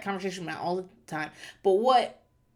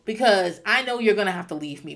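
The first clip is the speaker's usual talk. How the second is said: usual speaking voice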